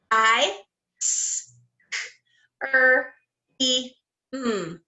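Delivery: each sound of ice cream said apart; sounded out letter by letter. Shown as a demonstration. Teacher sounding out a word for kindergarteners, one sound at a time. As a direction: segment the word into separate sounds for the kids. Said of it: "do it with our touch".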